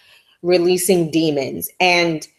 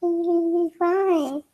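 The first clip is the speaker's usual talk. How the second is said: higher, gentle, a bit softer; a lightly voiced little comforting coo; tender comforting voice; 11 semitones higher, 6 dB quieter, slower than usual. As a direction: soothe her softly like a small child. Comforting words quoted, repeated.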